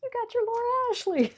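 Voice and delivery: high, loud, triumphant squeal; telling her eighties girlhood self she got her wish, in a high triumphant squeal; gleeful cheer. Retold or imitated. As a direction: cheer on your younger self like a proud big sister. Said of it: "giving this moment to nineteen eighties [name] to be able to say".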